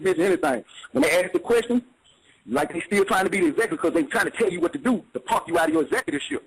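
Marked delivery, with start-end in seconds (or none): none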